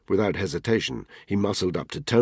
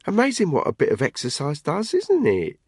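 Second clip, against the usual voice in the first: mocking mate; 11 semitones higher, 3 dB louder than usual. Tony teasing at barbecue.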